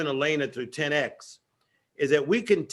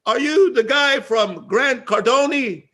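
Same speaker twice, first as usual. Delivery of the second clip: imitated eager call; 7 semitones higher, 6 dB louder, slower than usual. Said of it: "And he says".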